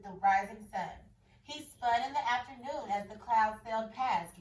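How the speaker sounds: expressive picture-book read-aloud; story reading. A narrator's reading. Teacher reading a picture book to her class.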